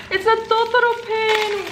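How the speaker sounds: very high-pitched excited squeal; thrilled squeal. Real, not acted. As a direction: squeal with sudden delight. Discovers a Totoro pin.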